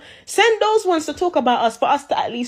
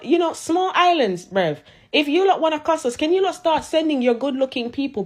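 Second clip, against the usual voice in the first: ordinary delivery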